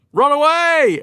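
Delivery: hammed-up warrior alarm; mock panicked cry. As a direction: sound the retreat in comic panic. Warriors fleeing the raging boy.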